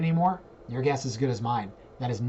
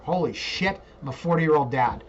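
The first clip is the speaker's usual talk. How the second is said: usual speaking voice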